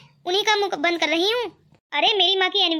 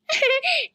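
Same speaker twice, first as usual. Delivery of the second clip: teasing laugh; 5 semitones higher than usual. Acted. Devar teasing mother about beauty.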